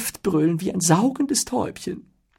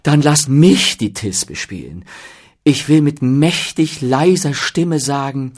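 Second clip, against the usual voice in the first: loud, drawn-out eager boasting; hammy, over-keen amateur actor; excited; 6 semitones higher, 10 dB louder than usual. A narrator's reading. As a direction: beg grandly for the part. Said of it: "aufgeregt ins Wort".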